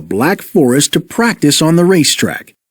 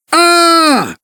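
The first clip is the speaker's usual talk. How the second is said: higher, hammed-up scream of fright; group scream; 13 semitones higher than usual. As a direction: scream leaping the ravine. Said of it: "they all screamed".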